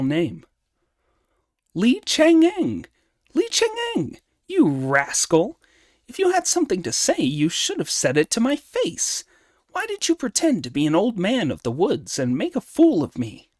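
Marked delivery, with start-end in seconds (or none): 1.7-13.5 high, forceful scolding monkey hero; Monkey, indignant. Acted. Monkey scolding the planet by his personal name, indignant.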